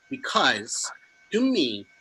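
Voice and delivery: ordinary talk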